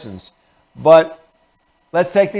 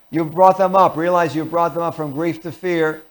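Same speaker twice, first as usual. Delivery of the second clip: ordinary delivery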